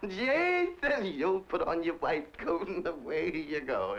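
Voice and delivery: high, slow bossy madam ordering him back to work; imitating the employer. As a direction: shoo the servant off like the lady of the house.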